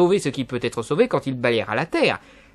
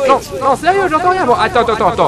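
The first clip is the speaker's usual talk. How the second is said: much higher, loud shouting; yelling across the room; 12 semitones higher, 7 dB louder than usual. Acted. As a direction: shout over the noise of rain. Can't hear Noé through rain.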